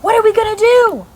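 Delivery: high, loud frightened cry; panicked child. Acted. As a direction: wail it in panic. Scared children in story.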